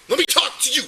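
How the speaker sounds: loud order to be silent; real forceful yelling; angry shouting. Real, not acted. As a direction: shout him down so you can speak. Officer shouts over interrupting man.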